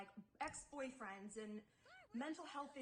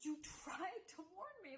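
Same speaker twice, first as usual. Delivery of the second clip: tearful; 7 semitones higher than usual. Real, not acted. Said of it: "I fucking hate to cry".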